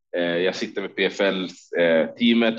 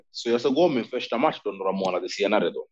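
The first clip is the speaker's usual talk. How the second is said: normal talking voice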